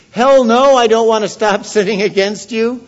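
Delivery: high, loud hammed-up hell no with a laugh; loud defiant refusal. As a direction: belt the refusal out, half laughing. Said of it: "Yeah, it's in the Bible".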